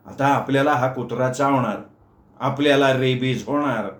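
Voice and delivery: usual speaking voice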